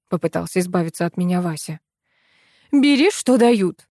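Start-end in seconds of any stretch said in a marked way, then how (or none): 2.7-3.9 high, slow, loud bark. A narrator's reading. Administrator forcing Viola on Vasya, barking. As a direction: snap an order angrily. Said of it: "рявкнула Наина Львовна".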